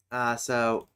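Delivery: ordinary talk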